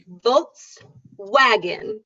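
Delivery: Volkswagen, very slow, bite for V, kiss for W; very slow model. Shown as a demonstration. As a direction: savour each sound. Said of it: "V, bite your lip".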